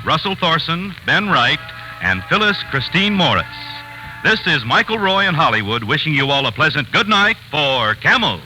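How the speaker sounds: ordinary talk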